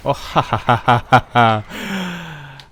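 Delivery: loud, rolling belly laugh; real roar of amused laughter; delighted laughter. Real, not acted. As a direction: burst out laughing, "ha ha ha". Reacts with delight to the play.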